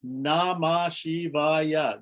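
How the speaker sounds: Namah Shivaya drawn out very slowly, each syllable separated. Shown as a demonstration. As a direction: count out Na-ma-Shi-va-ya by stretching every syllable. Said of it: "That's five syllables".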